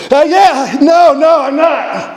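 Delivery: high-pitched, hurried call back. Retold or imitated. Hiker calling back to a wary stranger over the wind.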